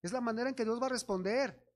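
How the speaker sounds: ordinary talk, nothing marked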